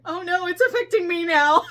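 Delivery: high, loud, hammed-up upset; mock distressed wail. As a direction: imitate someone starting to cry, loudly overdone. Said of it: "and she's going".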